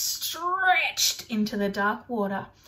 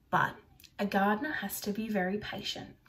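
usual speaking voice

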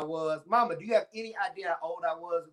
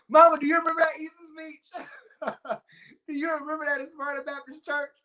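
1 teasing laughing; 10 semitones higher, 4 dB louder than usual. Retold or imitated. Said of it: "my mama just started laughing".

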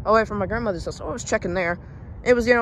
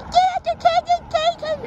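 very high, louder mock Karen squawk; Karen lady; 3 dB louder than usual. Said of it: "you don't have to be a Karen about it".

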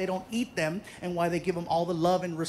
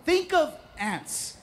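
slow emphasis; 7 semitones higher, much slower than usual. Real, not acted.

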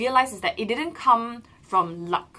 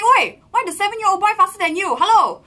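loud, higher mock coach yelling; imitating scolding coach; 10 semitones higher, 11 dB louder than usual. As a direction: bark at lazy swimmers like a coach. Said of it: "He's like".

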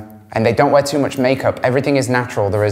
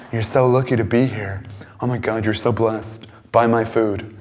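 softer, lower smug voice as a self-absorbed date; mock vain girl; 3 semitones lower, 5 dB quieter than usual. Imitating a self-absorbed girl on a dinner date, smug and soft. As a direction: sneer at the diner across the table.

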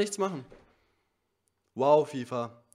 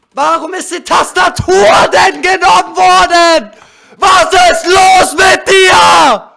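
berserk rage; 3 semitones higher, 10 dB louder, slower than usual. Real, not acted. Gamer raging at broken controller.

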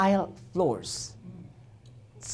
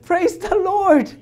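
high, loud, drawn-out exclamation; slow, ringing cry of thanks; jubilant praise; 11 semitones higher, 5 dB louder, slower than usual. Retold or imitated. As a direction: shout it out in triumph. Retold joy at laptop booting up.

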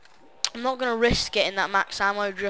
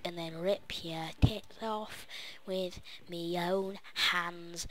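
slow menacing whisper; slower than usual. Acted. Menacing teacher character threat.

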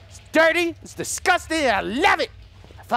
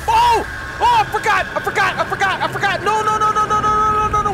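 panicked screaming; 10 semitones higher, 5 dB louder, faster than usual. Real, not acted. Chased, forgot, screaming.

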